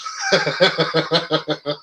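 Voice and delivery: a short, easy chuckle; real laugh. Real, not acted. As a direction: laugh it off lightly. Laughs after getting fired line.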